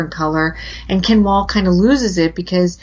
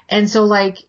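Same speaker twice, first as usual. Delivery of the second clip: ordinary talk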